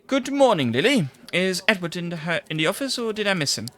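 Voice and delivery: bright, high, eager greeting; cheery reporter. Acted. Reporter greeting the secretary he fancies, high and eager. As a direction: chirp hello to the pretty secretary.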